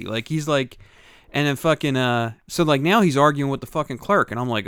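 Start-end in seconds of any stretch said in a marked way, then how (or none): none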